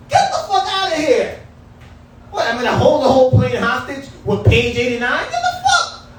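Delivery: mock outrage. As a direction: pile on the disbelief, half laughing. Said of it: "I was so mad".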